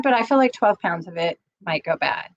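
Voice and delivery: ordinary talk, nothing marked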